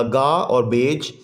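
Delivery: "गा और बेज" slow, as two separate syllables. Shown as a demonstration. Teacher showing pupils garbage's two syllables, slowly divided. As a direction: split "गा" from "बेज", low and slow. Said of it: "ये भी two syllable word है".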